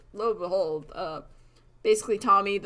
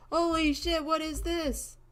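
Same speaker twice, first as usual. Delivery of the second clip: mock amazement; 9 semitones higher, slower than usual. Group discovering a pile of buttons, mock amazed. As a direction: lift the voice high in pretend astonishment.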